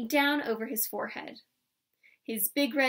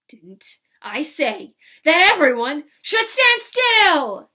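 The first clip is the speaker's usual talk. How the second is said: slow, much louder, higher roar; furious shout; 5 semitones higher, 13 dB louder, slower than usual. A narrator's reading. Laban shouting at Pippi, furious roar. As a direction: shout the crowd down. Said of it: "he shouted".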